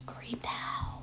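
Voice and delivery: very soft, voiceless verdict; whispered aside. Real, not acted. Mother ending her creepy story, hushed whisper. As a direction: let it out under your breath.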